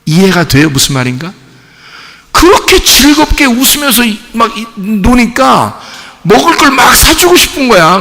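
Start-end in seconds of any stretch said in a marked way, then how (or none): 2.3-8.0 delighted parent. Acted. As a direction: gush like a parent watching happy kids. Preacher voices delighted parents.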